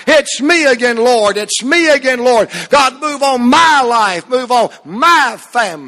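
calling out to God, each line falling at the end; fervent prayer. Acted. Preacher praying before the congregation, fervent prayer. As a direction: pray it aloud, pleading with the Lord.

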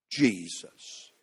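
low, soft, lingering Jesus; hushed reverent name. Real, not acted. Preacher savoring the name before his congregation, hushed and reverent. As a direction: breathe the name Jesus softly in awe. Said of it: "I just like the way that sounds".

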